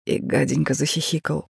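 ordinary delivery, nothing marked

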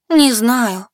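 high, loud tearful voice. A narrator's reading. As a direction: sob out a frightened answer. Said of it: "Я всхлипнула".

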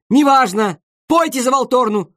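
high, loud barked order; commanding shout. Acted. Conductor shouting orders at the violist. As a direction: order him to sing the horn part.

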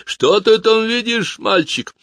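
a booming stage villain over a loudspeaker; amplified piper voice. Acted. Piper calls out to the boy on the rope, slow showman voice. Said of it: "многократно усиленный электроникой голос дудочника".

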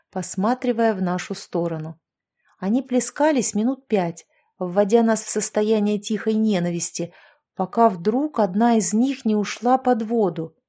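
ordinary delivery

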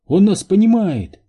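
high, loud cry of amazement; exclaiming. A narrator's reading. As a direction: burst out with the discovery. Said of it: "воскликнула Нелл". (поражённый высокий возглас)

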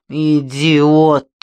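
loud, furious snap at a guard. Acted. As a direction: spit out the insult. Furious woman scolding guard.

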